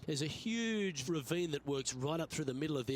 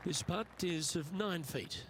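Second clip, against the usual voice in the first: calm golf play-by-play; measured broadcast golf commentary; golf commentator; 5 dB quieter than usual. Acted. Golf game commentary held throughout.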